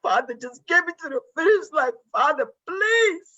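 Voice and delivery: high, tearful plea to God; pleading with the Lord, voice breaking; tearful begging. Retold or imitated. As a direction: beg Father, crying. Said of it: "because I'll beg".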